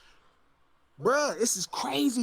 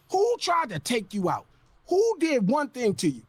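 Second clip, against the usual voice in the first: ordinary talk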